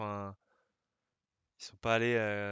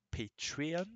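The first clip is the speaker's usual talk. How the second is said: Patreon said the English way as /petiɔ/; English accent; 4 semitones higher, 5 dB quieter than usual. Shown as a demonstration. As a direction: say Patreon like an American, not the French /patʀiɔ̃/. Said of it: "on va dire en, avec l'accent".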